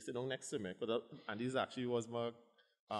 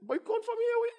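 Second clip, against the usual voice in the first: very high, loud shoo; doctor ordering the patient to leave, loud and high; doctor shooing him out; 10 dB louder than usual. Retold or imitated. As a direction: chase him out of the office loudly.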